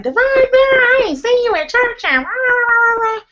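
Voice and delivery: very high mock choir-member voice; mocking imitation. Someone at choir practice going on about church, mock high-pitched imitation. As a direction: squeal it in a mocking, singsong blur.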